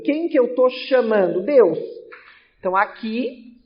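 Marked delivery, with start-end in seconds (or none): none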